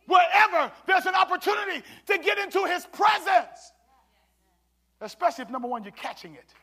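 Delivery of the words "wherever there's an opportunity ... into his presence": loud, rising preacher's call; preaching at full force. Acted. Pastor calling the mothers to come to the Lord, preaching loud. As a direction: preach it hard to the congregation.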